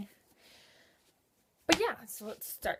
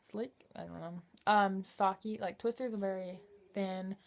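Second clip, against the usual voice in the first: ordinary talk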